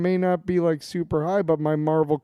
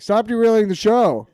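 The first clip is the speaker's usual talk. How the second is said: yelling; 7 semitones higher, 8 dB louder than usual. Retold or imitated. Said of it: "he'd be yelling at me like".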